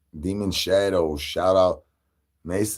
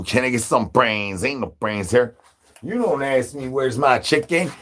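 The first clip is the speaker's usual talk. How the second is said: ordinary talk, nothing marked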